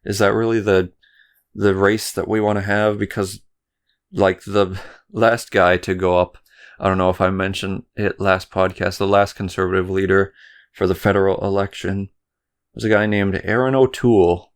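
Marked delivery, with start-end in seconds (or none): none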